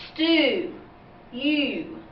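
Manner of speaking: stew with the U held long, saying its name; long vowel sound. Shown as a demonstration. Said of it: "vowel actually says its name".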